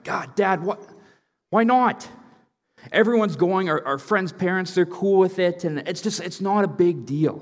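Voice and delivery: put-on high, whining teenager pleading with dad; whiny teen protest. Teen sons whining at their dad about the party, high whiny protest. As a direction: whine it like a teen who wants to go.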